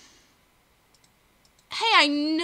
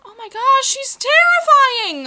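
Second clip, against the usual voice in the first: frightened; 6 semitones higher, 6 dB louder than usual. Real, not acted. Frightened by a sea serpent.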